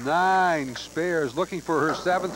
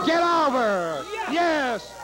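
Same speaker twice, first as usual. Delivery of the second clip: excited cheering; 11 semitones higher, much slower than usual. Real, not acted. Cheering the bowler's shot.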